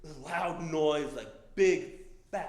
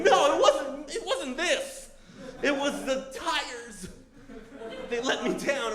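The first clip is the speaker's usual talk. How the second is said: frantic protest; 9 semitones higher, slower than usual. Retold or imitated. Rider blaming the tires to his waiting buddy, frantic protest. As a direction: protest in a high, worked-up voice, easing at the end.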